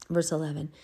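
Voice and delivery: ordinary talk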